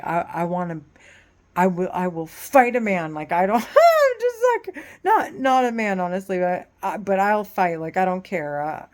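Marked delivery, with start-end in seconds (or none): 3.6-4.1 high, loud burst of laughter; sharp laugh. Real, not acted. Woman breaking into a high laugh mid-rant. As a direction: let out a sudden shrieking laugh.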